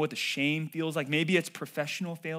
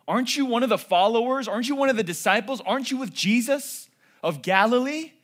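loud, higher, pressing questions; accusing questions; 7 semitones higher, 7 dB louder than usual. Acted. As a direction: press him hard with each question. Servants accusing Peter.

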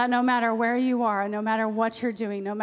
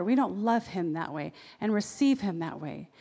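normal talking voice

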